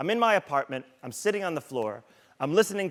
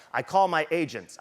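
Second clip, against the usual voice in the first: ordinary talk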